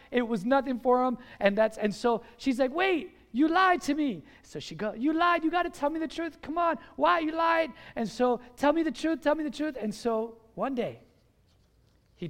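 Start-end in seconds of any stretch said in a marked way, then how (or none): 2.7-4.2 high-pitched acted indignation; indignant accusation. Delilah confronts Samson over his lie, indignant accusation. 4.9-7.7 high, insistent acted pleading; whiny nagging. Delilah badgers Samson for the truth, whiny nagging. 8.6-9.8 high, repetitive pestering; insistent nagging. Acted. Delilah demands the truth from Samson, insistent nagging.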